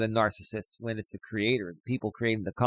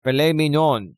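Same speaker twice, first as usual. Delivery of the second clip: put-on Greek accent; 4 semitones higher, 5 dB louder than usual. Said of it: "Even with his Greek accent".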